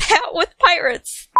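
plain reading voice

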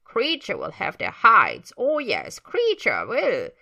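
loud, slow, swooping house-elf threat; Kreacher. Acted. House-elf vowing punishment to himself, loud menacing creature voice. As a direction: draw out the vengeful words in big pitch swoops. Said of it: "muttering voice of Kreacher as he wheezes".

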